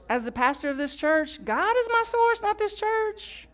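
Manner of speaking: ordinary talk, nothing marked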